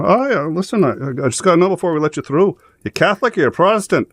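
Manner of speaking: high stage-Irish, casual checkpoint question; Irish guard. Retold or imitated. A checkpoint guard questions a driver, in a high Irish voice. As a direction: ask it in a high, easy Irish lilt. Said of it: "the guy goes up to him and goes".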